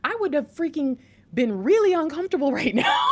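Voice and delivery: high, loud, breaking into laughter; excited outburst. Real, not acted. Excited outburst, laughing as she talks.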